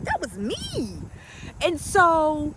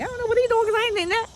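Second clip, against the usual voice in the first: mock refusal; 9 semitones higher, much faster than usual. Acts out refusing husband's new diet.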